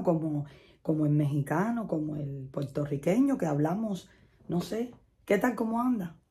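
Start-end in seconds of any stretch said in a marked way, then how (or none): 5.2-6.2 fast slurred Spanish. Shown as a demonstration. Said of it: "Que lo dice así".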